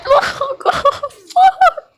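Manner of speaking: very high squealing cry; panicked shriek. Real, not acted. Gamer losing a firefight, panicked shriek. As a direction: shriek helplessly under fire.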